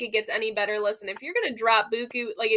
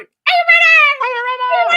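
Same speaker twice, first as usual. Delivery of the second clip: much higher, much louder hammed-up crowd hype; mock stage scream; 10 dB louder than usual. Imitating [name] hyping the crowd, a mock scream. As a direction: scream it like a worn-out rock singer.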